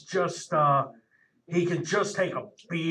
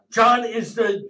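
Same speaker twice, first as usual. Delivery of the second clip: ordinary delivery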